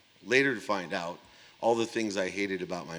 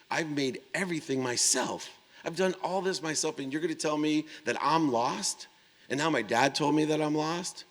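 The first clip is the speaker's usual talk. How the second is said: high-pitched, incredulous protest; indignant self-defence; 8 semitones higher than usual. Retold or imitated. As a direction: retell an argument, pitch pushed up in disbelief. Acted indignant quarrel with his mother.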